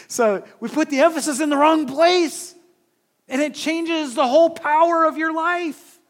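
high, very loud pulpit shout; full-force preaching. Real, not acted. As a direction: burst into loud, emphatic preaching.

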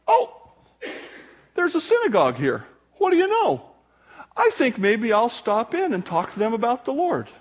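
a careless wanderer who just happened by; casual stumbling Paul. Pretend. Casual Paul strolling into synagogue.

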